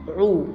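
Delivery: "عو" sounded alone, ayn into an u vowel; ayn with damma. Shown as a demonstration. Teacher shows first graders the damma sound, ayn with u on "عو". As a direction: say "عو" as ayn plus u. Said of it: "عليها ضمة".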